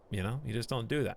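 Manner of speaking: ordinary talk, nothing marked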